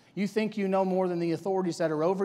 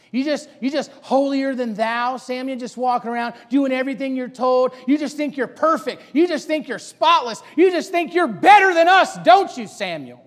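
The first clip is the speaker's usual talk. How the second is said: taunting, building higher and louder; mocking accusation; 5 semitones higher, 8 dB louder than usual. Peers accusing Samuel of being holier than thou, mocking. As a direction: needle him harder with each jab. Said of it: "Making fun of him".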